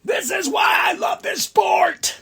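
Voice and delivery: very high ecstatic yell; sky-high cry of pure joy; joyful shout. Retold or imitated. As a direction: scream it like a thrilled fan. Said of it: "and I'm like".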